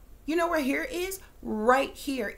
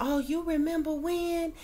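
higher, slow gushing imitation of an old classmate; old classmate gushing about the past, mocked higher and slow; mocking nostalgic acquaintance; 5 semitones higher than usual. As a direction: drawl it out like someone stuck reminiscing. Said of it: "all they got to talk about is the past".

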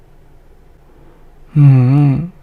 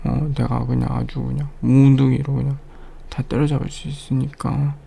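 normal talking voice

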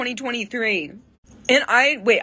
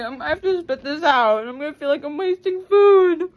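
tearful; 9 semitones higher, 4 dB louder than usual. Real, not acted. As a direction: plead it through tears. Upset over wasting food.

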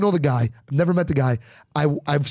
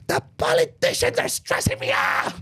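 mock stressed voice. Mocking a stressed-out person.

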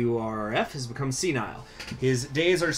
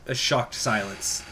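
ordinary talk

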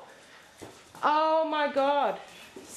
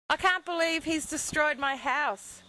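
ordinary delivery

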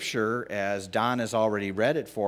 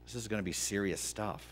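nervous aside; 3 semitones lower, 10 dB quieter, faster than usual. Retold or imitated. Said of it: "I'd be like".

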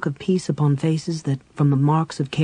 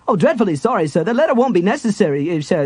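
high, stammering, apologetic guard; flustered guard; 6 semitones higher, 4 dB louder than usual. Acted. Guard realizing he stopped Lord Tanis, high flustered stammer. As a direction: trip over the apology in a panic.

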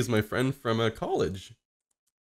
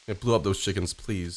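normal talking voice

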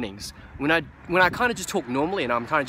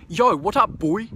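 raised, loud greeting in a New Zealand accent; 7 semitones higher, 6 dB louder, slower than usual. Shown as a demonstration. Vlogger contrasting the line in his less cool New Zealand accent. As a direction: call it out in your own New Zealand accent. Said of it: "talking in my New Zealand accent".

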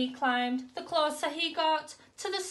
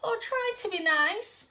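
frightened whimper; 8 semitones higher, 3 dB louder than usual. Acted. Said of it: "he whimpered".

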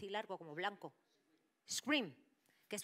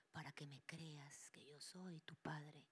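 slow demon whisper; 3 semitones lower, 13 dB quieter, slower than usual. Retold or imitated. Demon posing as father.

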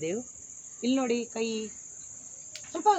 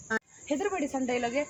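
ordinary talk, nothing marked

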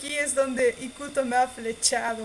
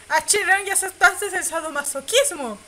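higher, much louder playful yell; teasing shout; 7 semitones higher, 9 dB louder than usual. Real, not acted. Player teasing the character about his future, loud playful yell.

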